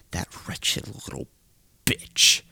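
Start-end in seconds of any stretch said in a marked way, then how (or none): none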